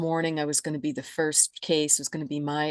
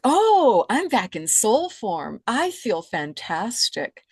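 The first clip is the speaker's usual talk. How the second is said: a high, loud "Oh" that bursts out, then settles; thrilled to be free of the body again; elated exclamation; 5 semitones higher, 5 dB louder, slower than usual. Retold or imitated. Soul rediscovering itself, exclaiming with joy. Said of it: "And I'm like".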